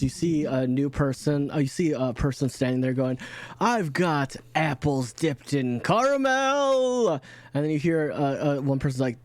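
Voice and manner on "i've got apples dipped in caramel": drawn-out, higher-pitched hawker's call; slow singsong market vendor call; street vendor's cry. Acted. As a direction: hawk your wares, stretching the words out. Street vendor calling out his wares.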